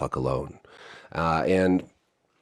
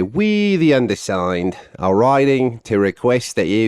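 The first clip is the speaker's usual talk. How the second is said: badly faked Australian reading voice; attempted Australian accent; 3 semitones higher, 3 dB louder than usual. Vlogger reading the group's open letter, bad Australian accent. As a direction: read it in a wobbly Australian accent. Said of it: "I can't do the Australian".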